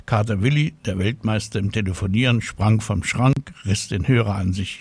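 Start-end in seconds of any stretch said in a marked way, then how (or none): none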